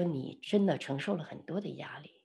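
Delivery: very soft, hushed consoling voice; retold tender sympathy. Speaker comforting a pressured friend who starts crying, tender. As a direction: speak gently, almost under the breath.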